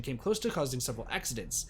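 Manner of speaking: ordinary talk, nothing marked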